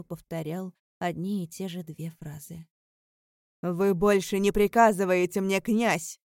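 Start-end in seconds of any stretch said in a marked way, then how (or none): 3.6-6.2 loud, defiant thunder. A narrator's reading. Alaric defying the prince, thundering. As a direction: boom it out to carry to the city walls. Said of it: "Гремел его голос".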